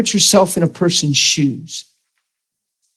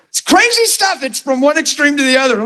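much higher, laughing remark; a high, amused aside; amused comment; 11 semitones higher than usual. Real, not acted. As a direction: still giggling after the impression. Laughing comment after the impression.

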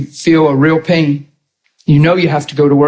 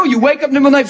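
normal talking voice

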